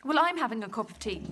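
usual speaking voice

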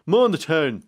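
slow Mon the town, Dundalk style; Dundalk accent. Shown as a demonstration. Presenter copies how a Dundalk man corrected him, slowly. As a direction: drag out Mon, Mon the town like a local. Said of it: "I was corrected ... by someone in Dundalk".

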